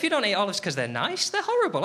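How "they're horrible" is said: high squeal of disgust; playful high revulsion; mock disgust. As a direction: spit it out in comic revulsion. Comic rant about olives.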